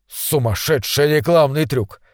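ordinary delivery

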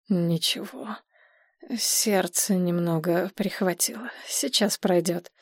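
soft, faint voice of someone unwell; weak, in pain. Acted. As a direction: play down the pain, barely any strength. Man with heart pain plays it down.

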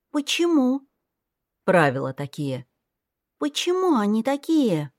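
put-on high child voice asking why; curious little girl. A narrator's reading. Little girl keeps asking her brother why. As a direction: wonder aloud like a small child.

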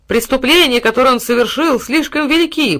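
high, loud roaring; donkey roar. A narrator's reading. Donkey roaring the charge at the court. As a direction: bray at the top of the lungs. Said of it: "проревел во всю глотку осёл".

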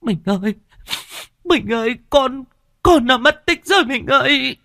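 high, sobbing plea breaking with grief; sobbing cry. A narrator's reading. Wife sobbing to her husband about their lost son. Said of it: "bà Thoa khóc nấc".

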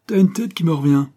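high, loud street-urchin voice; cocky street kid. Acted. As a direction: declare it loudly like a brash kid. Street kid takes to boy.

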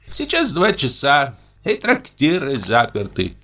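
dreamy tipsy inspector. A narrator's reading. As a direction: savour each treat aloud. (мечтательный подвыпивший инспектор)

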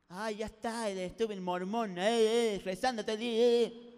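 loud, jeering sibling taunt; hermanito burlón. Acted. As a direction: heckle with drawn-out calls. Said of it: "siempre tu hermanito, viste, que viene". (burla de hermanito, a los gritos)

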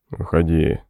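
low hoarse barked order; hoarse bass command. A narrator's reading. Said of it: "скомандовал хриплый бас". (хриплый командный бас)

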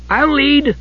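high, keen kender voice; eager announcement. A narrator's reading. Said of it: "Tass announced".

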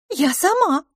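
high-pitched, heated retort. A narrator's reading. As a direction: answer back hotly in a high voice. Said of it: "горячо ответила мисс Лафос". (горячо, высоким голосом)

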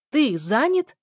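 high, loud incredulous question; shouting. A narrator's reading. Daughter to father claiming to be busy, shouting. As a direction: throw the question back at a shout, pitch rising. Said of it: "Я уже кричала".

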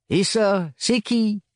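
curt mechanic. A narrator's reading. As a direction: ask it sharply, unimpressed.

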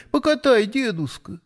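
put-on high childish begging; pleading little girl. A narrator's reading. Little girl asks grandfather for a ride, high and eager. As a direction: beg like an excited small child. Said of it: "захлопала Надюшка".

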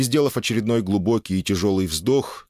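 usual speaking voice

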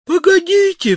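very high-pitched cry to wait; вскрик. A narrator's reading. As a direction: cry out urgently, voice leaping up. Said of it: "вскричал могильщик". (высокий отчаянный вскрик)

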